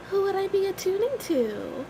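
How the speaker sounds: ordinary talk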